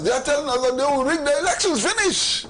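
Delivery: fast, high, climbing to a punched last word; indignant insistence. Real, not acted. Commentator exposing a party's rigging message, indignant. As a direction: rush it out, rising in exasperation.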